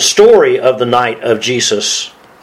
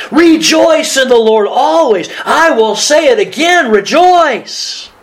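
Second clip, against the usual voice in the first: high, drawn-out jubilant proclamation; exultant; 11 semitones higher, slower than usual. Shown as a demonstration. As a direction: read it like you truly mean the rejoicing. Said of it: "Let's read it the way Paul meant it".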